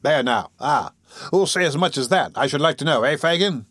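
ordinary delivery, nothing marked

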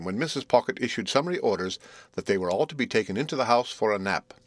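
plain reading voice

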